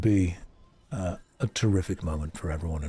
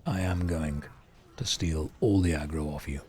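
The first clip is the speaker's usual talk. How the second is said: very deep voice. Shown as a demonstration. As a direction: drop into your deepest register. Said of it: "the balls that have dropped to get this voice".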